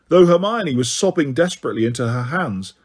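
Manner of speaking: ordinary delivery, nothing marked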